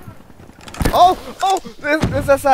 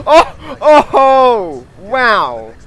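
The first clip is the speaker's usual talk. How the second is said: loud, high amazed cries; amazed shouting; 9 semitones higher, 11 dB louder, much slower than usual. Real, not acted. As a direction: cry out in amazement. Amazed at play.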